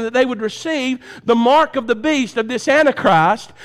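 loud, high hellfire sermon; preaching at full force. Real, not acted. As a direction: preach it hard to the back row.

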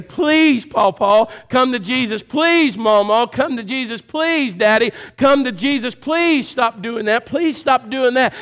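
high, loud begging at a deathbed; children begging a dying parent to come to Jesus; pleading family. Retold or imitated. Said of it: "and they say".

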